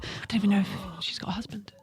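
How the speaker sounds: hushed worried whisper. Acted. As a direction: whisper it under breath. Panicked crime whisper scene.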